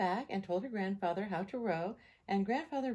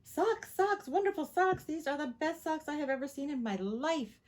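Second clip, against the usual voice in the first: high, loud overjoyed shout about socks; excited yell; 7 semitones higher, 3 dB louder than usual. A narrator's reading. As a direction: shout it out with delight. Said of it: "Tina ran and yelled".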